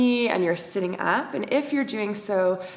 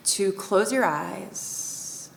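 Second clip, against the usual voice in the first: guided meditation; 5 dB quieter than usual. Acted. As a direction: guide the breath in and out, calm and unhurried. Leads breath meditation.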